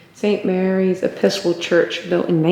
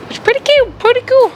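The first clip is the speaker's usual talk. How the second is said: much higher, squeaky-cute gush; 5 dB louder than usual. Real, not acted. Praising the little museum, high cute gushing voice. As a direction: coo over it sweetly.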